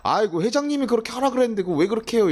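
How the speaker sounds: mock exasperated employee; complaining. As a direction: grumble to the team leader. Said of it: "여러분 한번 할 거예요".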